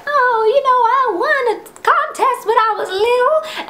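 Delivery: hammed-up innocent small talk; fake casual. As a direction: [name] faking casual chatter. [name] playing it off.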